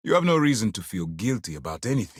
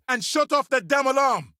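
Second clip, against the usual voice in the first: high, loud irritated yell; exasperated shout, pitched way up; shouted command; 5 dB louder than usual. Acted. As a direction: yell the order over an alarm. Barking an order over an alarm.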